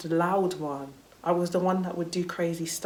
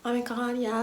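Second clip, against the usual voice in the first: high-pitched insincere farewell; sugary fake affection, pitched high; fake gushing; 7 semitones higher than usual. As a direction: imitate a gushy school-leaver's goodbye. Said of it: "you're just never talking to her again".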